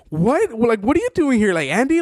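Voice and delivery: high-pitched, incredulous questioning; surprised recognition. Retold or imitated. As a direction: act out someone amazed to spot a friend. Acts out Greg's surprised recognition.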